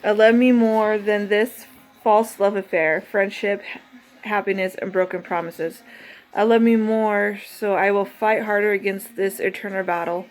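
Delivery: measured, earnest recital of her poem; deliberate spoken-word affirmation; poem reading. Acted. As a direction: read each line like a vow to yourself. Reads her self-love poem.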